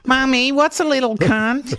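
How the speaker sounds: high, put-on kiddie voice; little child. Child asking Mommy about the swearing, high innocent voice. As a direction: ask it like a puzzled little kid.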